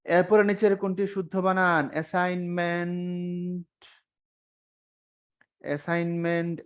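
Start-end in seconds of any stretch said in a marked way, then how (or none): 1.9-3.8 drawn out slowly, syllable by syllable; slow spelling demo. Shown as a demonstration. Teacher to students, spelling the word out slowly. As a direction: stretch each part of assignment slowly like reading it aloud. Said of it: "syllable দিয়ে দিয়ে পড়তে পারেন".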